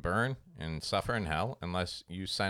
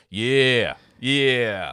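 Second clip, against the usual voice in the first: hype man echo; 8 dB louder than usual. Retold or imitated. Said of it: "repeat the last word".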